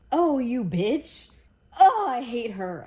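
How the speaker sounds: loud, indignant reader's rant; angry outburst. Real, not acted. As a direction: explode with hatred at a villain. Furious at a book character.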